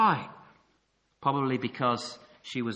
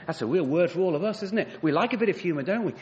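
usual speaking voice

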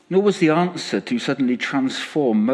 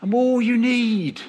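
tender promise; 9 semitones higher than usual. Retold or imitated. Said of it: "he calls Moses".

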